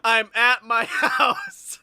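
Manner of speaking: slow, loud, laughing slur; laughing drunk voice. Retold or imitated. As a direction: laugh through a drunk answer. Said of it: "And you're like".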